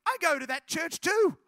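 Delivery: loud, high burst of recognition; retold girl's thrilled outburst; excited exclamation. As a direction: exclaim it as an amazing coincidence. Second coincidence, girl exclaims in retelling.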